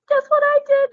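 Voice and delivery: very high, loud burst of news; excited announcement. Retold or imitated. Student bursting to tell her teacher about passing, excited. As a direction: squeal it like big news.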